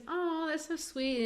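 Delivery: high, slow, gushing mock charm; charmed girl. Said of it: "And she goes".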